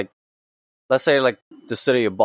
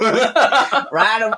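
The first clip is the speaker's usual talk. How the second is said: mock cowboy call; 9 semitones higher than usual. Cowboy joke, laughing.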